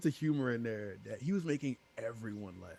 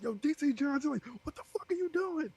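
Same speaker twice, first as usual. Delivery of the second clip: much higher, panicked yelling at a teammate; frantic callout; 3 dB louder than usual. Retold or imitated. Jay panicking at D C Johnson over chat, much higher. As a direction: shriek at the teammate who ran off.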